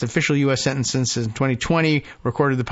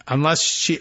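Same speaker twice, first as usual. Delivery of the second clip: ordinary talk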